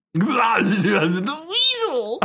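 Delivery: high, slow squeaky weasel; sly hissing weasel. Acted. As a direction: stretch it out like a weasel. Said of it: "your outstanding weasel impression".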